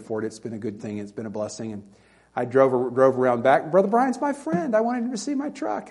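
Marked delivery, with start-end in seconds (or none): 3.7-5.9 high-pitched, eager show-off; eager retelling. Retold or imitated. Preacher recalling showing his friend a new truck, eager. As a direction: retell it in an excited, much higher voice.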